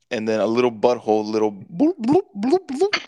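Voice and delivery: bloop sound effect. Retold or imitated. Friend mimics rocks dropping into the pool, rising bloops. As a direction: play the rocks plopping in.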